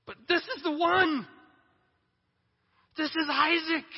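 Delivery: high, strained pleading to God; anguished Abraham. Acted. Abraham begging God over his son, high strained plea. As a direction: plead with God, voice pushed up in pain.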